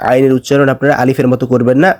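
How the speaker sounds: ordinary talk, nothing marked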